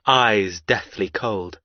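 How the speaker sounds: usual speaking voice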